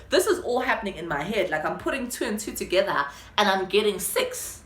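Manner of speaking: ordinary talk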